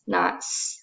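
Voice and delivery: wrong long "S" hiss; "S" hissed long and doubled; doubled s sound. Shown as a demonstration. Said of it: "just says one S".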